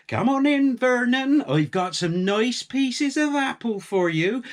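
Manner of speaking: high, coaxing call to a pig; sweet calling voice. A narrator's reading. Farm wife coaxing the pig with apples. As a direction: sing it out to the pig.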